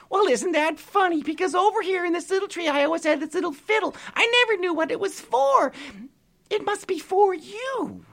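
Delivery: grateful pixie. Acted. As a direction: thank him like a tiny delighted fairy. Pixie figure held voice.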